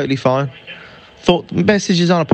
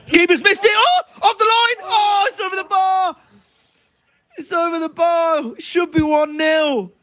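high-pitched shouted commentary; excited shout. Real, not acted. Fan commentating on a missed chance, excited high shouting. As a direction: cry out in disbelief at a near miss. Live reaction to a near miss.

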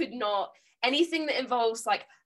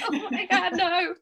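high, laughing oh my God no; giggling mock dismay; laughing despair; 10 semitones higher than usual. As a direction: crack up in mock horror. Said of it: "I'll be like".

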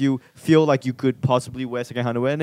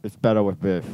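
low put-on Australian; Australian imitation, pitched down; attempted Australian accent; 7 semitones lower than usual. Shown as a demonstration. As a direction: try a deep Aussie accent. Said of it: "I don't know how to talk like you guys".

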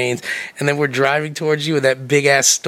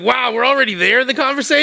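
surprised; 8 semitones higher than usual. Retold or imitated. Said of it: "I was like".

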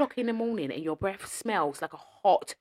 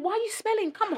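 high, disbelieving question; incredulous; 14 semitones higher, 3 dB quieter than usual. Real, not acted. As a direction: squeak in disbelief. Ranting about smelly commuters.

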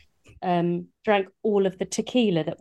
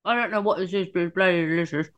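talking through a mouthful of chocolate; words round a chewed sweet; mouth full; faster than usual. Real, not acted. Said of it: "you've got your mouth full".

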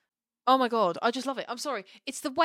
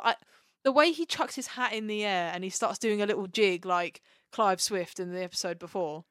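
ordinary talk, nothing marked

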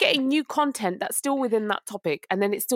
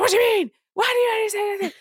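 high, louder exasperated demand; retold angry demand; 11 semitones higher, 3 dB louder, faster than usual. Mother retells confronting her husband, high exasperated. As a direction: fire it at him in disbelief. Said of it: "I was like".

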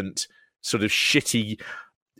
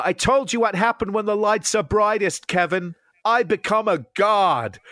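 mock shouting; 9 semitones higher than usual. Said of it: "he's shouting at him".

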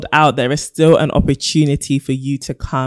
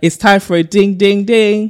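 sing-song announcement; 5 semitones higher, 4 dB louder than usual. Acted. Announcing the dilemma segment.

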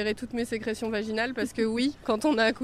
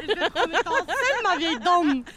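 put-on lecherous old man voice; 6 semitones higher than usual. Lecherous role voice.

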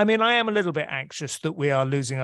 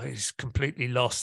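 ordinary talk, nothing marked

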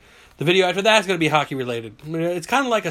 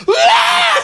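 loud high terrified screams; mock screams; 10 dB louder than usual. Rider waking mid-flip, loud mock terror scream.

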